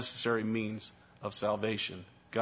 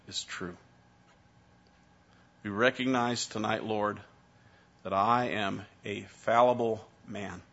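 pastoral prayer. Acted. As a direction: pray humbly over the congregation. Closing prayer.

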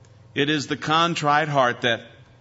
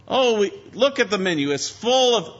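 higher, pleading waiter in a skit; insistent waiter; 8 semitones higher, 4 dB louder than usual. Acted. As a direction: push the menu on the diner, pitched up. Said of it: "the waiter would say".

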